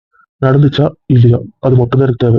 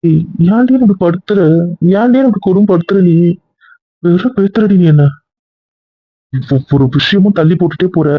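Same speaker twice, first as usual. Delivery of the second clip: raised, higher voice, pained and frustrated; scene: husband pressing his wife to finally tell him, exasperated; exasperated pleading; 4 semitones higher than usual. Acted.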